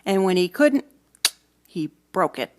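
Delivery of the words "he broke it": ordinary delivery, nothing marked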